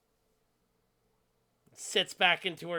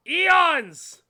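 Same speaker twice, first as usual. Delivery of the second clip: shouted; 12 semitones higher, 11 dB louder than usual. Acted. GM NPC ranting.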